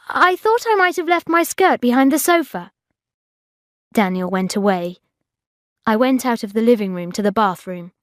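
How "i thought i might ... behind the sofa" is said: high, over-innocent explanation; flustered excuse. A narrator's reading. Bridget caught behind Daniel's sofa, flustered excuse. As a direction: cover up with a high-pitched excuse.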